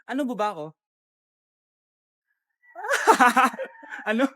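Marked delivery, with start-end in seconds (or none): 2.8-3.6 spontaneous burst of laughter; real laugh. Real, not acted. Laughing between takes.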